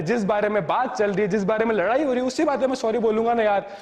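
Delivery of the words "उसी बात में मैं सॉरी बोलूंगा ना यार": ordinary talk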